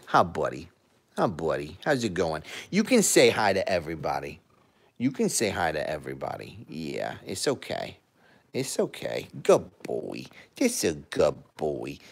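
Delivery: low, soft cooing to a pet. Real, not acted. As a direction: coo gently to the cat. Soft baby talk to cat.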